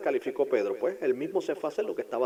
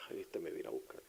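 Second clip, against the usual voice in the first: hushed worried whisper; whispered aside; 6 semitones lower, 14 dB quieter than usual. Acted. Peter realising Jesus came for him, whispered. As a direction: breathe it like a secret fear.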